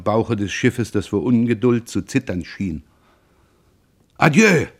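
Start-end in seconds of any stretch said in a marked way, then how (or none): 4.2-4.7 quick, raised hasty farewell; hurried goodbye. A narrator's reading. Roland rushing his farewell to Pierre, hasty raised call. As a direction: Roland calls it out in a rush. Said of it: "sagte Roland immer in Eile".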